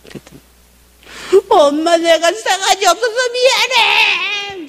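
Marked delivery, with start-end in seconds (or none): 1.5-4.7 high, loud, drawn-out bawling; mock wailing. Daughter apologizing to mom for rudeness, wailing. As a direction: imitate a child bursting into sobs. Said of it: "통곡을 하기 시작을".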